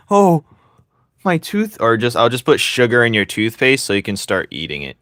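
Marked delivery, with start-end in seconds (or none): 0.1-1.8 high squeaky mock pain; mocking mint fan. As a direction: squeal it like the toothpaste stings. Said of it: "You're just like".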